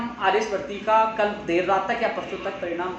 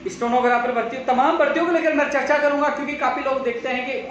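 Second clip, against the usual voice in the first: energetic stream intro; 6 semitones higher, 4 dB louder than usual. Real, not acted. Live stream announcing topics.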